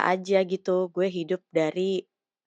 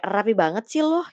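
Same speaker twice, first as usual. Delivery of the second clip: higher-pitched casual compliment, rising at the end; simple remark; 4 semitones higher, 6 dB louder than usual. Retold or imitated. Someone commenting on a neat outfit, simple remark. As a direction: toss it out as a simple comment, lifting on "lo". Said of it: "ngomongnya sederhana nih".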